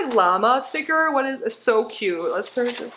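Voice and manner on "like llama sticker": usual speaking voice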